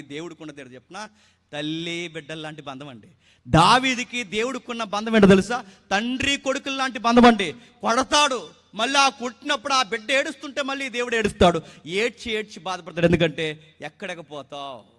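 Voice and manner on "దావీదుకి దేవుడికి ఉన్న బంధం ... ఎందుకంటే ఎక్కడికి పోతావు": loud, high, impassioned sermon delivery; fervent preaching. Acted. Pastor preaching to the congregation, loud and fervent.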